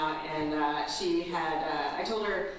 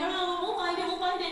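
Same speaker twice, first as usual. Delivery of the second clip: reassuring mom; 9 semitones higher than usual. Retold or imitated. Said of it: "I'm like".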